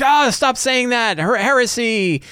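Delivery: high, slow scandalized cry of heresy; scandalized mercenaries. Acted. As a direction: recoil at blasphemy, drawing it out. GM voicing scandalized dwarf mercenaries.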